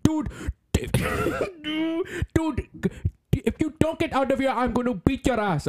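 high-pitched tough-guy threat, hammed-up; mock tough dude. Imagined dude squaring up to the speaker, mock tough. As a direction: get in their face in a high, silly put-on voice. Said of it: "talk like this and be like".

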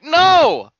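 high, loud cry of protest; outraged shout. Retold or imitated. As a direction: yell it in exasperated protest. Retold outrage at episode break.

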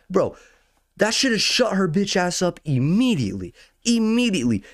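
fed up and incredulous about her; venting at the nosy woman, high and heated; indignant outburst. Real, not acted. Indignant rant about the lady.

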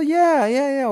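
high-pitched, awkward yeahs. Retold or imitated. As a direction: brush it off with squeaky yeahs. His awkward reply to the man. (尴尬的高音附和)

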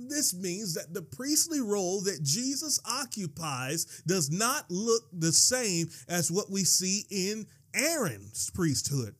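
usual speaking voice

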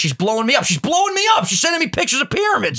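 yelling at the phone as it keeps buzzing; excited outburst. Real, not acted. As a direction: pitch jumps way up, shouting in disbelief. Phone flooded with AI messages.

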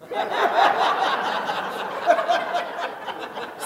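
high airy laugh; real laugh at his own joke; laughing to himself; breathy laugh. Real, not acted. Own joke about Jesus.

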